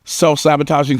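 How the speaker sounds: ordinary talk, nothing marked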